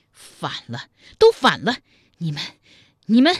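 too furious to finish, explode in fragments; enraged spluttering. Acted. Enraged princess splutters at defiance. (气得语不成句，猛然怒喝)

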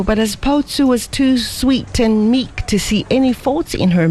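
high, heated scolding; angry lecture. Acted. Ada scolds Lin Fo, high and indignant. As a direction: lay into him with indignation.

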